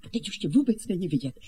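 ordinary talk, nothing marked

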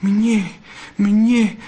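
high, voiced gasp of disbelief; whispered sob. A narrator's reading. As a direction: squeak it out, overcome. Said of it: "zašeptala a zaštkala". (zaštkaně)

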